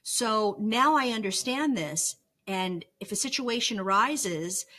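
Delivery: ordinary talk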